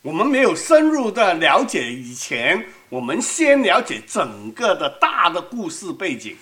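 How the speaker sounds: usual speaking voice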